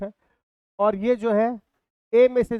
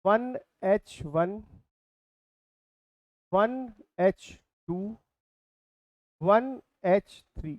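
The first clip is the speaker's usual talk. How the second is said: each isotope spelled out very slowly, word by word; slow dictation; much slower than usual. Shown as a demonstration. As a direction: dictate it slowly so students can write. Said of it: "hydrogen तीन रूपों में पाया जाता है". (धीरे-धीरे लिखवाते हुए)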